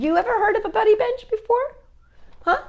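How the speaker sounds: high question. Acted. Asks kids brightly.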